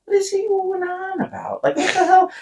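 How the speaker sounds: high, hushed mock bewilderment; bewildered retort. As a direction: act out being baffled in a high, small voice. Acts out own bewildered thought.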